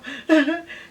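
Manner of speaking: quick high chuckle; brief amused laugh; short laugh. Real, not acted. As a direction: let a real laugh slip out. Laughing about liking hot dogs now.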